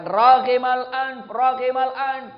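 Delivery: each word drawn out slowly and loudly, falling on ragimal; solemn slow recitation. Acted. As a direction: intone the Prophet's words, heavy and deliberate. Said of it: "tiga kali". (dibaca lambat, keras dan khidmat)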